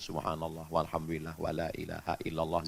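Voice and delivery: very soft, low, even recitation; murmured dhikr. Shown as a demonstration. Parent doing dhikr while driving the child, softly murmured. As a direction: recite it low under your breath.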